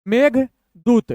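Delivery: slow, pause between the halves; broken in two. Shown as a demonstration. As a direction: split it into two-letter chunks. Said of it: "दो दो अक्षर तोड़ तोड़ के".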